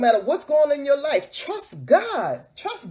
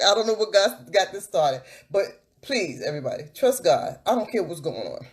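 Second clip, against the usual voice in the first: usual speaking voice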